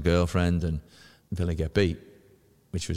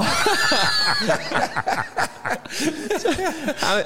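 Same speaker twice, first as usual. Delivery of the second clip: big laugh. Real, not acted. Laughing at own story.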